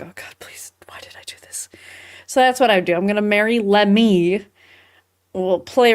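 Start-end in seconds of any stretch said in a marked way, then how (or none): 0.0-1.7 hushed mock despair to herself; whispered regret. Imitating her own regret.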